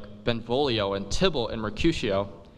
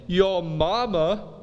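slow, drawn-out, higher taunting yo mama; mock taunt; 6 semitones higher, 3 dB louder, much slower than usual. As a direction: sneer it out slowly. Insult quoted as teen slang.

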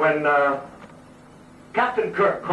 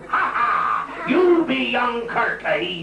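hearty pirate-ish engineer; 7 semitones higher, slower than usual. Retold or imitated. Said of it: "All we have".

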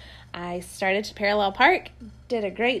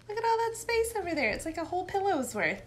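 much higher, fast and bright with delight; excited showing off; 8 semitones higher, 5 dB quieter, faster than usual. Real, not acted. Mom showing viewers her roomy chair, delighted and high. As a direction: show off the chair's space like a treasure.

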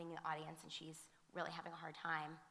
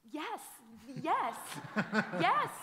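much higher and louder, bursting with delight and a laugh; overjoyed yes; 8 semitones higher, 12 dB louder, much slower than usual. Retold or imitated. Telling the boy he did the right thing, ecstatic yes. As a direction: cheer it out, thrilled. Cheering the boy's right choice.

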